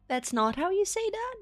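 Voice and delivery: high, disbelieving question; retelling her own baffled reply; incredulous. Retold or imitated. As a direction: act shocked it's wrong. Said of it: "and I was like".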